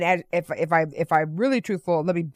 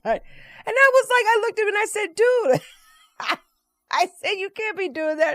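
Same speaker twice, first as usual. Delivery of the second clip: laughing; 13 semitones higher than usual. Real, not acted. Laughing while scolding him.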